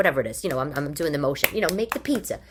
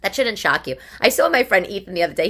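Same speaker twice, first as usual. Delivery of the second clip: usual speaking voice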